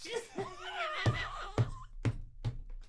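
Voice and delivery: high, quiet wheezing laugh; real cracked-up squeaky laughter; helpless laughter. Real, not acted. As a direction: laugh till it turns into a squeal. Reactor cracks up at the clip.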